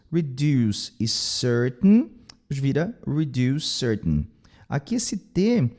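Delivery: ordinary delivery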